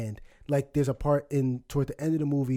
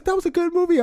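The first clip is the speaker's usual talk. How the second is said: mom's tearful reply; 5 dB louder, faster than usual. Retold or imitated. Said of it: "mom voiced in story".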